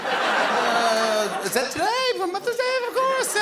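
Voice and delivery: very high, strained squeak; hammed-up high-pitched panic; mock panicked husband. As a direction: caught out, voice shooting way up. Acted husband caught forgetting Mother's Day.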